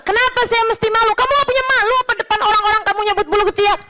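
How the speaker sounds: high-pitched, rushed mock argument; retold indignant quarrel. Two men argue over shame before people, high indignant back-and-forth. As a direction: fire it back in acted disbelief, pitched high.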